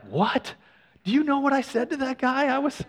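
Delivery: high-pitched, incredulous angry outburst; retold anger. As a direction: imitate an angry dad, pitch jumping up. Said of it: "he was really angry".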